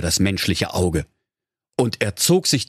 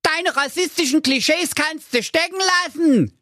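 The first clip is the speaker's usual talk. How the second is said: hissing. Acted. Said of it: "zischte der Ninja".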